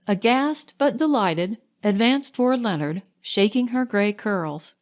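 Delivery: usual speaking voice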